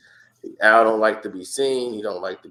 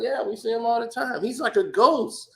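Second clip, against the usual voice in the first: casual; 10 semitones higher than usual. Retold or imitated. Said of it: "It's like".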